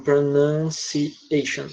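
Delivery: softly drawn-out syllables of "pronunciation"; slow dictation. Real, not acted. Teacher typing the word in chat for students, slow dictation. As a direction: sound out "pronunciation" slowly while typing it.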